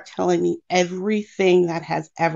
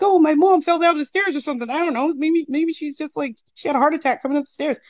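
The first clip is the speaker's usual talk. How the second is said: higher, quicker retold worried rambling; imitated worried kid; 9 semitones higher, faster than usual. Kid imagines mom hurt on the stairs, worried rambling. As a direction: act out a child's racing worries. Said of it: "as a kid, you're like".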